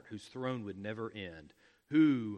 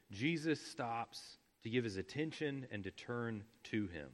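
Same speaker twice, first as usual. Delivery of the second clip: ordinary talk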